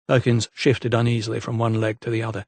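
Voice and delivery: ordinary delivery, nothing marked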